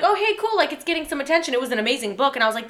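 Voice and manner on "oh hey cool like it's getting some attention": high, bright-eyed fan gush; excited quote. Retold or imitated.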